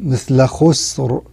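خسر said slowly with a heavy, full-mouthed R; slow Quran-style demo of خسر. Shown as a demonstration. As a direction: stretch خسر, R thick and dark. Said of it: "حالات تفخيم الراء".

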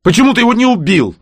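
fast, high, loud question; high-pitched, rushed outburst; indignant demand. A narrator's reading. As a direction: fire the accusation out quickly. Piv demands an explanation.

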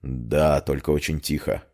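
low, soft male character voice; hushed reply. A narrator's reading. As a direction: answer gravely, keeping it low. Friend answers grimly after checking heartbeat.

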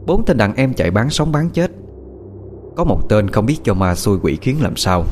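usual speaking voice

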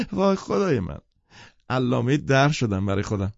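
high laugh full of scorn, voice jumping up; Daisy mocks her own worldliness with a thrilled laugh; mocking excited laugh. Daisy laughing mockingly at her own sophistication. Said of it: "هیجان زده خندهٔ تمسخر آمیزی کرد".

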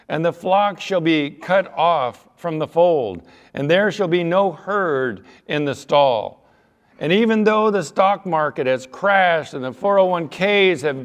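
slow, loud, declaiming scripture; preached scripture reading. Acted. Preacher booming scripture to his congregation. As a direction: proclaim the verses to the congregation.

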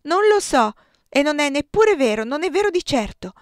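high, loud, desperate shout; frantic shouted refusal to the maid; shouting denial. Acted. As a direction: push her away with a yelled no. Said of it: "la respinsi e gridai".

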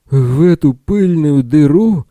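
high, loud, slow hoarse yell. A narrator's reading. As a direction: bellow it out, rasping. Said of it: "хрипло заорал Билл".

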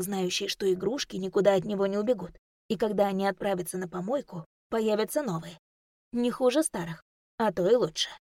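ordinary delivery

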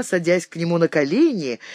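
ordinary delivery, nothing marked

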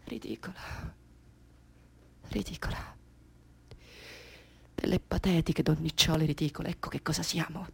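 very soft, low, almost whispered; deflated self-contempt. Acted. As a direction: drop to a crushed murmur. Collapse after the outburst. (avvilita, con un filo di voce)

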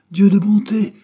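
loud, high shocked exclamation; scandalized shout; crying out. A narrator's reading. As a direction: burst out in outrage. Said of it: "cria Dobbs".

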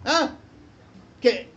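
usual speaking voice

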